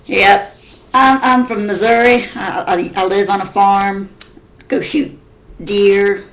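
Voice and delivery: loud Missouri hillbilly twang. Shown as a demonstration. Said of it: "Missouri hillbilly redneck".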